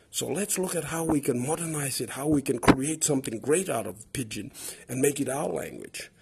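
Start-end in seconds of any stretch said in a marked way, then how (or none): none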